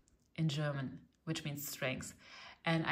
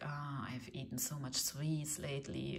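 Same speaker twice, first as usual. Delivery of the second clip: guilty whisper; 4 semitones lower, 7 dB quieter than usual. Retold or imitated. Voicing self-reproach, whispered.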